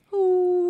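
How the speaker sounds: high, loud imitated puppy howl. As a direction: howl it like a little dog. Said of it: "un aullido de un perrito que hace". (aullido agudo de perrito imitado)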